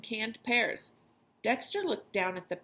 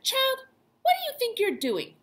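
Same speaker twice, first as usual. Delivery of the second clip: scolding mom; 5 dB louder than usual. Retold or imitated. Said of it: "Mom would have said".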